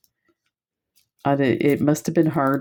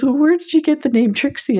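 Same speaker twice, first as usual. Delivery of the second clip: quoting Jen; 11 semitones higher, faster than usual. Retold or imitated. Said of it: "Jen goes".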